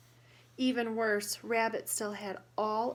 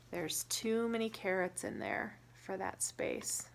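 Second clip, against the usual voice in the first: plain reading voice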